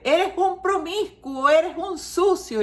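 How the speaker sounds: high, slow, harsh reproach; scolding mother. Retold or imitated. Mother insulting her teenage son, in mock anger. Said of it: "La madre".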